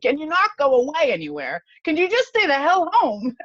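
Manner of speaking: high, loud exasperated mock family voice. Family begging her to stay home, high exasperated mock plea. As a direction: plead like a fed-up relative. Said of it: "my family's like".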